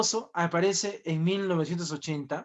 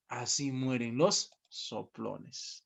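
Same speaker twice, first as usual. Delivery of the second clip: slow hushed reading; 6 semitones lower, 9 dB quieter, much slower than usual. Retold or imitated. Teacher repeats the sign's words.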